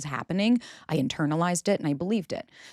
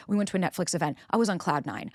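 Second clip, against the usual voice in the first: ordinary talk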